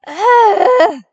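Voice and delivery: loud, high exasperated ugh; groaning in exasperation at endless meetings; frustrated groan. Acted. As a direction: let out a fed-up groan.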